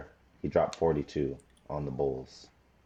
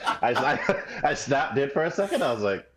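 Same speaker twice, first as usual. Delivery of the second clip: high-pitched laughing talk; 10 semitones higher, 3 dB louder than usual. Real, not acted. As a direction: crack up while explaining. Cracking up at own mishearing.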